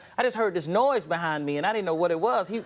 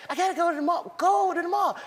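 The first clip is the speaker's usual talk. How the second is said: whiny little push to go out; 11 semitones higher than usual. Retold or imitated. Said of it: "He was like".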